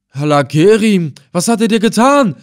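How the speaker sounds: high, loud wail of dismay; horrified outcry. A narrator's reading. Old man hearing his grandson threatened, horrified. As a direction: cry out, pitch climbing high.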